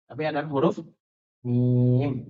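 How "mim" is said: "mim" with a long held i, lower pitch; long vowel. Shown as a demonstration. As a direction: draw out "mim" on one low note. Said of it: "dibacanya panjang". ("mim" dibaca panjang)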